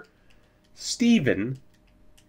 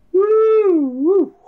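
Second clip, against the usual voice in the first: high, loud woo of victory; cheering; 6 dB louder than usual. Real, not acted. Gamer cheers his team's win, high whoops. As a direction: whoop like your team just won.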